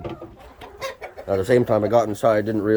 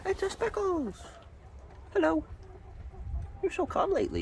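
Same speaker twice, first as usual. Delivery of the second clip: pet talk; 8 dB quieter than usual. Acted. Greeting a hen.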